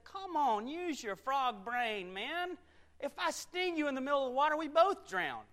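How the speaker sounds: high, loud, incredulous reasoning; exasperated persuading. Acted. Scorpion reasons with the doubting frog, high exasperated pleading. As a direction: argue as if it were obvious.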